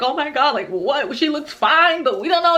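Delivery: high, flustered mock panic; freaking-out friends. Friends puzzled by her screaming, freaking out. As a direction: imitate friends losing their cool. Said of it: "they're freaking out".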